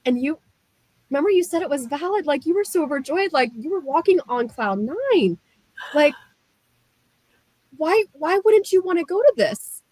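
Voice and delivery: high, puzzled imitation of the husband; perplexed husband. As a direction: play the baffled husband, pitch well up. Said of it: "he's very perplexed".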